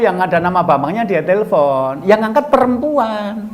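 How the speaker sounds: ordinary talk, nothing marked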